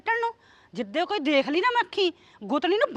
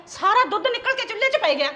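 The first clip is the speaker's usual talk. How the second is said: usual speaking voice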